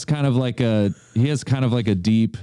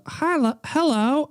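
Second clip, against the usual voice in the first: higher, a tentative try at Elmo; 12 semitones higher than usual. Pretend. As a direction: test a high puppet voice. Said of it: "what does Elmo sound?".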